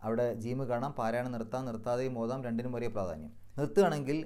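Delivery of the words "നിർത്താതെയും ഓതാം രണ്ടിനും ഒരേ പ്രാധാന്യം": ordinary talk, nothing marked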